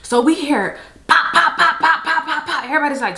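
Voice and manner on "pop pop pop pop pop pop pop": high rapid popping noises; imitating gunshots. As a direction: mimic the gunfire pops. Gunshots heard again.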